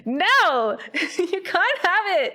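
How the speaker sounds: high, loud no, then laughing slow refusal; teasing no through laughter. Retold or imitated. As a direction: cackle out a teasing no. Refusing the orb again.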